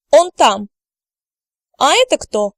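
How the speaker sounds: ordinary talk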